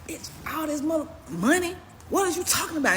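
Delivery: high, incredulous outraged yell; reliving the angry showdown, pitched high; angry retold confrontation. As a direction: demand answers like you can't believe it. Confronting the girlfriend, pissed.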